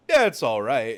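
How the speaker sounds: loud mocking quote; mock offhand verdict. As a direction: blurt it out as an exaggerated imitation. Friend's reaction, retold mockingly.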